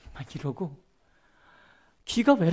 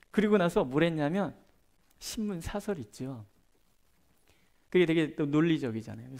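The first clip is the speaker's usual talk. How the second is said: ordinary talk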